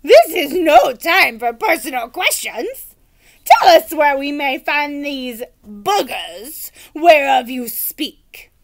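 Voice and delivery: loud, slow pompous knight; old knight voice. Acted. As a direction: declaim grandly.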